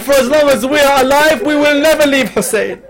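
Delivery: high, loud, driving majlis recitation; impassioned preaching. Acted. Preacher recounting the companions' vow, impassioned and loud. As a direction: preach it out to the crowd.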